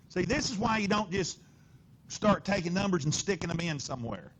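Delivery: energetic lecturing, voice lifted and loud; animated teacher push. Real, not acted. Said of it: "I just put inflection in my voice".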